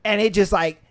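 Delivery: ordinary talk, nothing marked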